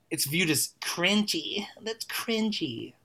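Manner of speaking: whispered, high mocking "that's cringy". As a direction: mimic the crowd dismissing the song in a whisper.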